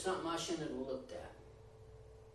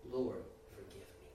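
quiet prayer; 9 semitones lower, 9 dB quieter than usual. Retold or imitated. Prayer voiced in sermon.

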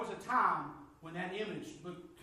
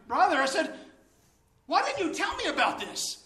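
much higher and louder, overjoyed; excited plea; 11 semitones higher, 12 dB louder than usual. Retold or imitated. Asking the brother why he never mentioned the books, loud and high. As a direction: cry out to him, half laughing.

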